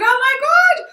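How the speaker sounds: very high, loud, drawn-out squeal; thrilled mock squeal. Actress hearing she got the lead, thrilled squeal. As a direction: reenact squealing with sheer excitement. Her reaction to getting the lead.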